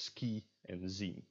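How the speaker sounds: "ski" and "zien" with long ee; tense I. Shown as a demonstration. Teacher reads example words, tense ee in "ski" and "zien". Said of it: "the tense words are pronounced".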